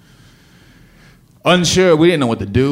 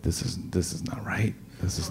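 hushed alarmed whisper; 14 dB quieter than usual. Retold or imitated. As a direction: whisper it in dread. Alarmed realization in car.

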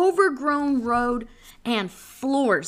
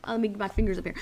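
usual speaking voice

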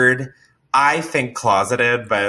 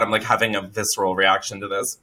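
ordinary talk

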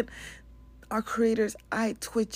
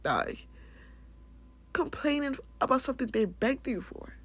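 usual speaking voice

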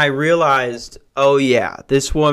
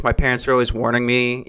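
ordinary talk

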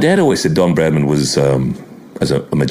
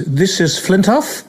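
slow high commentator call; measured, drawn-out TV commentary voice; Richie Benaud impression; 11 semitones higher, slower than usual. Mimicking Benaud calling the cricket on TV.

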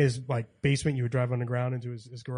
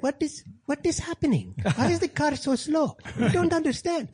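much higher-pitched mock dad voice; baffled dad; 12 semitones higher than usual. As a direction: mimic a dad puzzled and complaining, pitched way up. Imitates dad baffled by slow car.

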